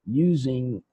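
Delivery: usual speaking voice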